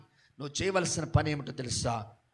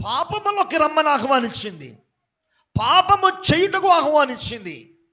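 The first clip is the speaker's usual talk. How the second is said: raised, driving sermon voice building up; preacher warning the church, pressing harder and louder; fervent preaching; 11 semitones higher, 15 dB louder than usual. Acted. Preaching at full force.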